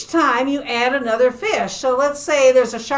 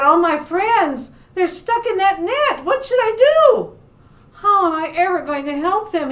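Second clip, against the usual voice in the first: high, dismayed storybook voice; worried shark; 8 semitones higher, 3 dB louder than usual. Acted. Shark sees friends stuck in a net, worried. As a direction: fret aloud with rising pitch. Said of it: "he thought".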